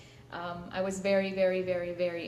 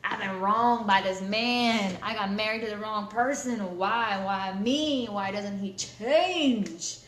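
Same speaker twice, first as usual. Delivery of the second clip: whiny self-pity; 3 semitones higher, 6 dB louder, slower than usual. Retold or imitated. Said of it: "getting ready to tell him, like".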